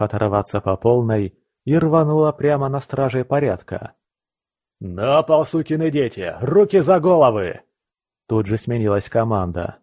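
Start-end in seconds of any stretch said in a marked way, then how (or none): 4.8-7.6 police command. Acted. Guards barking commands.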